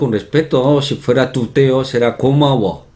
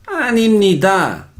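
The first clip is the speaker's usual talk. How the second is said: mock Italian accent, high and slow on "animnida"; 9 semitones higher, slower than usual. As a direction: imitate Italians singing out "animnida", syllables separated. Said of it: "cuando hablan en coreano, pronuncian así". (acento italiano imitado, agudo y cantado)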